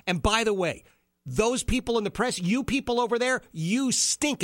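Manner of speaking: mock Trump jab. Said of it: "turns around and goes".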